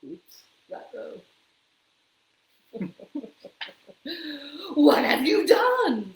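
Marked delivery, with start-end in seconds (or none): 4.7-6.1 loud, slow dismay; the mayor loudly aghast at the cut ribbon; upset mayor. A narrator's reading. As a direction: demand it, aghast. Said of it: "said the mayor".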